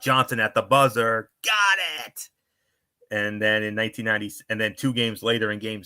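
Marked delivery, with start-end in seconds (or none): 0.0-2.3 building to a high-pitched call; mock buzzer-beater scream, leaping up at the end; imitated announcer's scream. Recalling the announcer's buzzer-beater call, imitated scream. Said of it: "when he screamed out".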